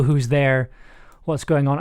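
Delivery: ordinary talk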